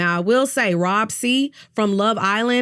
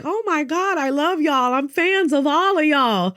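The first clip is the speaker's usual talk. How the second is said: put-on higher gushing sweet old lady voice; mocking Donna; 6 semitones higher than usual. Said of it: "she's just in there like".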